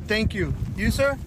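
ordinary talk

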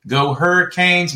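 ordinary talk

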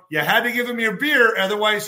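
usual speaking voice

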